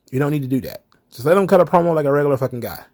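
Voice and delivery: normal talking voice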